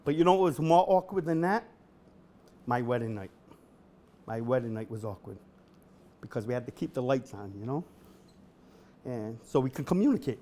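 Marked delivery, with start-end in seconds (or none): none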